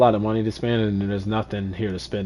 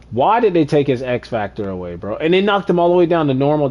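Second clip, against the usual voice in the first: loud, higher-pitched protest; indignant; 5 semitones higher, 6 dB louder than usual. Real, not acted. Gamer protesting his player's downgrade, indignant. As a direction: complain in raised, incredulous voice.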